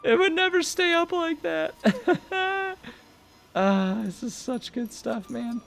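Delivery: very high, laughing falsetto; squealing laughter. Real, not acted. Gamer laughing at a top-heavy head, very high squeals. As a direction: squeal through the giggles.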